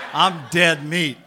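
slow, loud, laughing as he says it. Real, not acted. Imagining being caught on video, slow loud laughter. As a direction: give up to the joke, laughing. Laughing at his own embarrassment.

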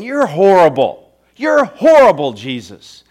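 loud, high jeer, dropping on the name; hurled insult. Acted. Crowd abusing Jesus in their own words, hurled insult. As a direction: hurl abuse with rising force. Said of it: "hurling at him".

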